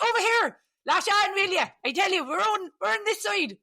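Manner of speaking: high, loud, hammed-up Irish shout; bad Irish accent, calling across the hedge, high and loud; terrible Irish accent. Lads at the hedge calling riders over, in a hammed-up Irish accent. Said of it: "I'm gonna do a terrible Irish accent".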